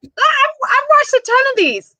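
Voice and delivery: very high, squealed confidence; excited know-it-all. Retold or imitated. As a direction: gush it high, playfully bragging. Retold eager reaction at birth.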